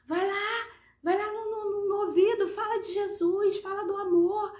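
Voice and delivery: high, insistent retold urging; urgent plea. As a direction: re-enact begging her husband to act. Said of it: "eu falava pro meu marido assim".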